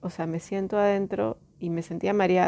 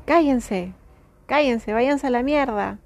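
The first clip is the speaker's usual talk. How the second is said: raised voice, higher and louder; retold shout; 5 semitones higher, 5 dB louder than usual. Woman retelling how she told the voices in her head off, retold shout. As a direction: re-enact yelling them away, short of a full scream. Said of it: "grité".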